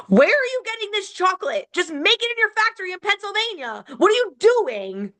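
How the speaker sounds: high-pitched indignant rant at the brand; outraged rant. Real, not acted. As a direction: demand answers from the company in disbelief. Ranting at the chocolate company.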